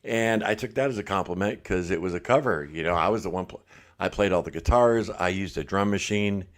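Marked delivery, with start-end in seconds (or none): none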